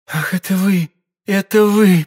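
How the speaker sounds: high, loud cry of relief and joy; joyful cry. A narrator's reading. Woman recognising her rescuer, crying out in joy. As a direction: burst out in glad recognition. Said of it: "вскрикнула от радости".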